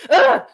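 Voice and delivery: loud, high exasperated "ugh"; frustrated groan. Retold or imitated. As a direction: let out a fed-up groan. Voicing Nneka's frustration.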